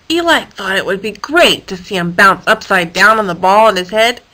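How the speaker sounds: ordinary delivery, nothing marked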